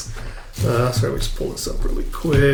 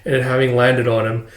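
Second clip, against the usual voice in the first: ordinary delivery, nothing marked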